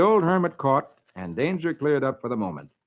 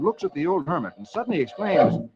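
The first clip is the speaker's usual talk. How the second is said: usual speaking voice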